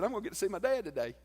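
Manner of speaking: high, fast, eager announcement; imitating an excited, eager son. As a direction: blurt out happy news, high and quick.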